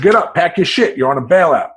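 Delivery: guard yelling pack your shit; guard shouting orders. Retold or imitated. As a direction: shout it down at a sleeping inmate.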